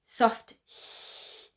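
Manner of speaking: a soft unvoiced "sh" the way ich ends; soft sh demo. Shown as a demonstration. Teacher demonstrating the soft ch sound to students, unvoiced. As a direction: breathe out a gentle "sh" after an I. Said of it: "We've got the".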